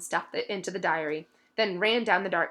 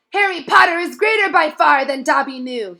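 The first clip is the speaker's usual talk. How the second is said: higher, louder tearful elf; sobbing elf; 8 semitones higher, 11 dB louder than usual. A narrator's reading. Dobby hugs Harry, sobbing with gratitude. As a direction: cry it out with gratitude. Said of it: "he sobbed".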